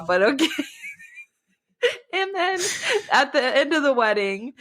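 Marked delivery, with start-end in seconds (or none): 0.5-4.5 breaking into high laughter mid-sentence; can't get the words out for laughing. Real, not acted. Friend retelling a book ending, laughing.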